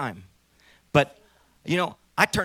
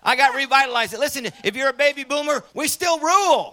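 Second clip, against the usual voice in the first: loud, high, rousing sermon voice; fiery preaching; 9 semitones higher, 8 dB louder than usual. Acted. Preacher firing up baby boomers at the conference, rousing shout. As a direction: lift the room like a revival preacher.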